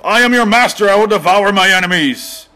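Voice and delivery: booming villain boast, pitched high and loud; menacing wrestler voice. Pretend. Mock commentator voicing the wrestler's villain boast. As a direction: bellow it like a cartoon wrestling heel.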